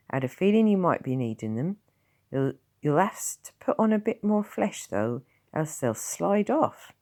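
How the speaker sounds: lightly voiced gruff Tom; a hint of a rough old villager; gruff old countryman. Tom's voice.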